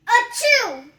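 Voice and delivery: loud fake sneeze; big sneeze. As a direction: blast out a giant achoo.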